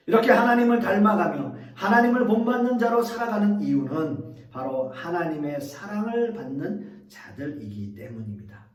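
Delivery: ordinary talk, nothing marked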